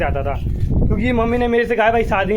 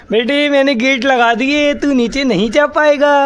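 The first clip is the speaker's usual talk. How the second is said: slow, higher, louder teasing; teasing taunt; 5 semitones higher, 4 dB louder, slower than usual. Real, not acted. Teasing the dog that the gate is shut, slow mock taunt. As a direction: tease the pet, drawing it out.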